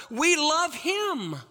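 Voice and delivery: high, drawn-out emphasis; soaring climax. Real, not acted. Preacher reaching his point for congregation, high and drawn out. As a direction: lift it like a peak.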